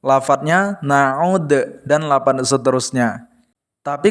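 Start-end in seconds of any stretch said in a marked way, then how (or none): 0.8-1.5 na'ud, slow and deliberate; na'ud said slowly. Shown as a demonstration. Teacher demonstrating na'ud slowly to a pupil. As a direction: draw out na'ud syllable by syllable. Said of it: "saat wasol".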